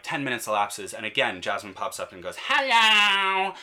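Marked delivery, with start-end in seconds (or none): 2.5-3.6 much higher, louder, insistent "Hello!"; a toddler demanding attention. Retold or imitated. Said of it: "some demanding tones in Jasmine's hellos".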